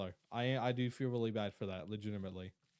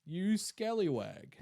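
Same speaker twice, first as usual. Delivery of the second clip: slow, higher, drawn-out mock scolding; put-on scallywag voice; 4 semitones higher, 3 dB louder, slower than usual. Streamer retrying a lost voice, put-on voice. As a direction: stretch it out in a higher silly voice.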